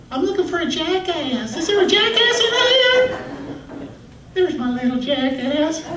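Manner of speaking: very high put-on Southern teacher voice; South Carolina teacher. Substitute teacher hunting for a pupil, high Southern call. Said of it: "a substitute teacher from South Carolina".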